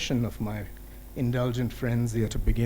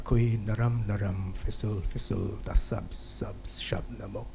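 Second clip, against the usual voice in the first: poem recitation. Acted. Poet reciting a Kashmiri anthem, declamation fading into whisper. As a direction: launch the refrain strongly, then sink gradually into hushed repetitions.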